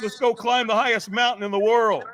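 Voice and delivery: high-pitched hyped-up eagerness; acted euphoria. Firewalker ready for Everest, acted overexcited bravado. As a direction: play someone on an unstoppable high.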